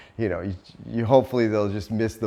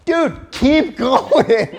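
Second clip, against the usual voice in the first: high, slow, laughing plea to drive on; laughing at the driver who slams on the brakes; laughing exasperation; 12 semitones higher, 7 dB louder, much slower than usual. Retold or imitated. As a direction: beg through laughter, much higher and slower. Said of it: "and I'm like".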